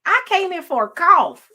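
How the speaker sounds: high, loud disbelieving cry; how did we get here, half laughing; exasperated high outburst; indignant outcry. Real, not acted. Indignant about doctor visit.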